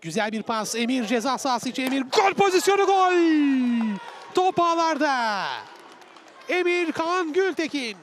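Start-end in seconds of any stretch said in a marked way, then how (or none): 1.8-8.0 loud, high goal call; screaming football commentator. Real, not acted. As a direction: shout the goal to the stadium. Goal scored, live call.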